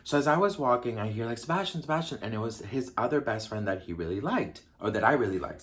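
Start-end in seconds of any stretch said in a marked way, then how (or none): 1.4-2.2 calling out. Retold or imitated. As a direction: imitate someone calling after a friend. Friend calling out after him.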